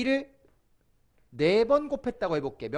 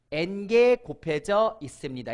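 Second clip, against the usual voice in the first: slow model answer; 4 dB louder, slower than usual. Shown as a demonstration. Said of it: "다른 말로 하면".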